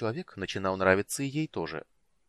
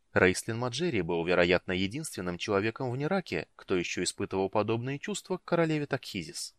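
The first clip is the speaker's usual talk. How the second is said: ordinary delivery, nothing marked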